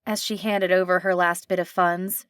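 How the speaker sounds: ordinary delivery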